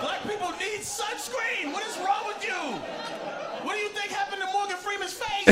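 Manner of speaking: high-pitched comic yelling; hammed-up, squeaky indignation; outraged rant. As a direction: rail at them in disbelief.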